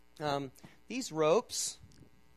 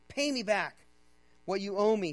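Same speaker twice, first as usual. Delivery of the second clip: raised, louder demand; 5 semitones higher, 8 dB louder than usual. A narrator's reading. Servant choking his fellow servant over a debt, demanding. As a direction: read it as a forceful demand for money. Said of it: "he demanded".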